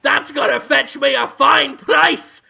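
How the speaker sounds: high, shouted cartoon villain; scheming neighbor, loudly amazed. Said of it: "played by [name]".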